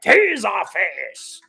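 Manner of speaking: relishing the name. Shown as a demonstration. Streamer relishing a silly villain name, drawn out with gusto. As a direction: savour Taserface! like a wrestling intro. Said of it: "I love saying that".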